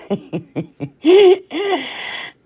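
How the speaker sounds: high, breathy giggle at her own slip; embarrassed laugh. Real, not acted. Crafter laughing at her own fumble on camera, embarrassed giggle. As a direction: laugh it off, caught out mid-sentence.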